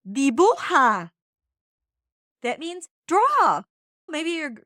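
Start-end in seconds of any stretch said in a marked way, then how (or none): 0.1-1.1 "Dibuja" spoken slowly and loud as a command; Spanish command. Shown as a demonstration. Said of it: "So here it is".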